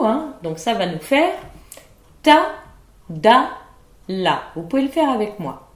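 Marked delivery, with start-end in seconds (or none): none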